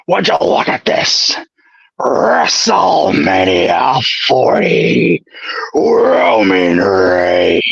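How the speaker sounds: raspy, drawn-out shout, dropping very low; throat-burning Vince hype, stretched slowly; Vince McMahon impression. Friend names Vince, he roars out the WrestleMania hype. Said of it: "Vince McMahon".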